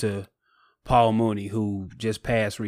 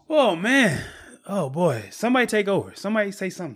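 choked up; 8 semitones higher, faster than usual. Real, not acted. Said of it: "I'm getting choked up".

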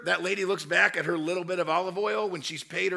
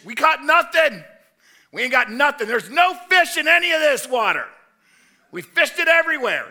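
much louder and higher, fed up; frustrated fishermen shouting; 9 semitones higher, 12 dB louder than usual. Retold or imitated. Disciples shout to Jesus from the boat that they caught nothing, exasperated yelling. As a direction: yell it back across the water, exasperated. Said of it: "when Jesus yells out to the disciples".

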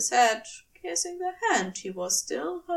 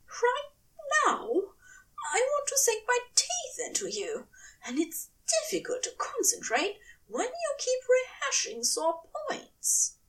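higher, pressing angel voice; hungry impatient angel; 13 semitones higher than usual. A narrator's reading. Angel scolding his lover for dwelling, higher and eager. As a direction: let the hunger push through.

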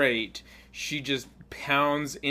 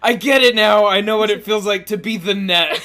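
mock epiphany; 5 semitones higher, 9 dB louder than usual. Shirogane's epiphany retold loudly.

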